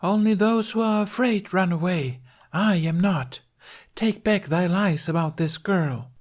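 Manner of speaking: high, defiant theatrical declaration; stage knight. A narrator's reading. Knight defies the three accusers, declaiming high. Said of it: "as Yvain again".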